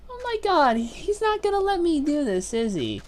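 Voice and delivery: high, loud disbelieving cry; oh my god, he really won't let me; exasperated outburst. Real, not acted. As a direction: shout it at the screen in disbelief. Exasperated at game options.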